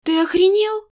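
furious cry at the king; furious shout. Acted. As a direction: scream it in disbelief. Heroine explodes at the king.

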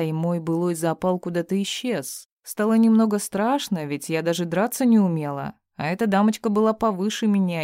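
plain reading voice

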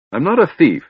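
high, loud protest; indignant. Acted. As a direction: bristle at the accusation. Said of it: "Tass said indignantly".

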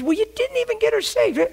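high, sneering put-on voice; preacher mocking shallow-minded critics. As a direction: mimic a smug critic.

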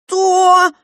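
high, loud cry of horror; alarmed shout. A narrator's reading. Father hearing daughter threaten to hang herself, shouting. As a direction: shout in alarm to stop the child. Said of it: "закричал папа".

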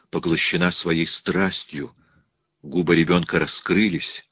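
ordinary delivery

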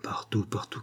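a queen muttering under her breath; whispered to herself. A narrator's reading. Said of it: "comme à elle-même".

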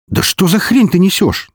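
high-pitched exasperated question; indignant. Acted. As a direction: burst out in protest at the nonsense. Hero protests, is interrupted. (возмущённо, высоким голосом)

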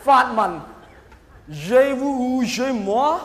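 ordinary talk, nothing marked

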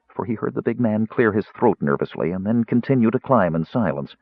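plain reading voice

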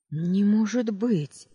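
high, slow horrified cry; shocked cry. Acted. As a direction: gasp it out in disbelief. Heroine shocked by the attack.

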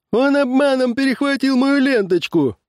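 very high-pitched complaint; aggrieved accuser. A narrator's reading. Farmer accusing rival over blue ribbon, aggrieved. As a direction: protest in a high, indignant voice.

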